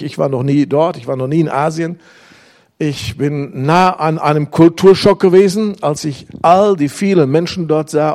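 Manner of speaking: usual speaking voice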